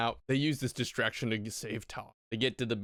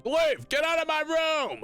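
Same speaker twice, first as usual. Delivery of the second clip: high, loud ordering out; mock angry yell; 5 dB louder than usual. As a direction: yell like a fed-up parent, hammed up. Acted parent throwing kids out.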